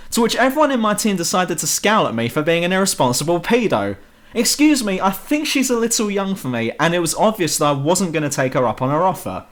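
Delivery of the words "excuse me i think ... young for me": indignant protest. Real, not acted. Defending himself against team scowls.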